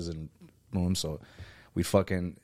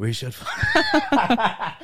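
high, very slow drawn-out laughing yell; teasing a friend's hookup, a long laughing cry; laughing mock shout; 13 semitones higher, much slower than usual. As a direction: stretch it out while cracking up. Friend mocks the couple, laughing.

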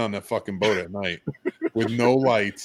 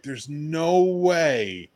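very slow, drawn-out disbelief; incredulous disbelief; 5 semitones higher, much slower than usual. Retold or imitated. Telling a friend how stunned he was by his anger, slow drawn-out disbelief. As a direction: stretch each word in amazement. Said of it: "I'm like".

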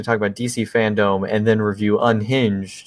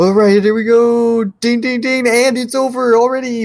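excited announcer; 12 semitones higher, 4 dB louder than usual. Pretend. Mock fight commentary ending.

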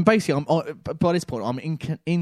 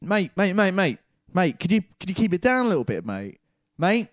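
raised, pleading call of mate, mate; shouting over noise; 8 semitones higher than usual. Retold or imitated. Calling to the bin man over the truck, raised shouting. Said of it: "So I start shouting at him".

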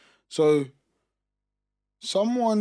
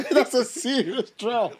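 laughing through the words; cracking up mid-sentence; 11 semitones higher, 7 dB louder, slower than usual. Real, not acted. Laughing joke.

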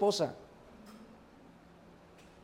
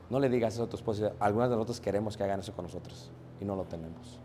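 softer, lower, fast confiding plea; a single woman quietly telling her the truth; quiet lonely sister; 8 semitones lower, 8 dB quieter, faster than usual. Retold or imitated. Unmarried sister quietly scolding her sister, soft and rueful.

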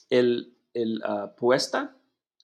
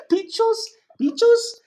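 1 laughing disbelief; 9 dB louder than usual. Real, not acted. Learner laughs at her mistake.

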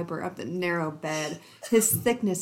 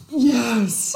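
a louder, higher mock growl on yes; 4 semitones higher, 7 dB louder than usual. Shown as a demonstration. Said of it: "With a growl".

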